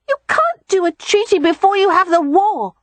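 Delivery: put-on high, loud child voice; small boy objecting indignantly; protesting little boy. A narrator's reading. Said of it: "Rye protested".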